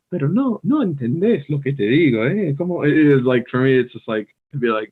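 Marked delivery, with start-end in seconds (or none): 0.1-2.8 high, lilting, energetic Spanish; lively, emphatic Spanish delivery; animated Spanish. Shown as a demonstration. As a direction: act it out in Spanish with big swings in pitch. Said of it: "it's hard to act like".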